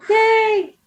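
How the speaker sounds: high, bright little cheer; excited cheer. Real, not acted. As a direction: cheer the guest in. Cheering guest's welcome.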